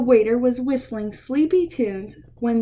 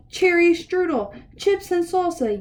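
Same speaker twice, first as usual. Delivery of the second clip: lightly voiced yippy poodle, higher; yipping poodle; 6 semitones higher than usual. Reading to kids, the poodle yipping its order at the waiter. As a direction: yip the order up high. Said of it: "yipped a poodle".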